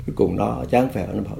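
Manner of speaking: ordinary talk, nothing marked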